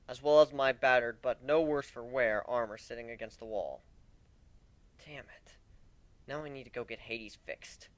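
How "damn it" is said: soft breathy whisper; hushed frustration; whispered curse. Acted. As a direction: curse under your breath. Muttered frustration.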